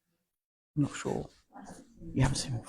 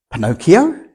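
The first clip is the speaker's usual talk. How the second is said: doubtful; 5 semitones higher, 6 dB louder than usual. Acted. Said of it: "looks back doubtingly and says".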